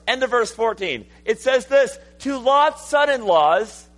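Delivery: loud, high, urgent pulpit delivery; full-force preaching. Real, not acted. Preacher relaying the angels' warning to Lot, full-force preaching.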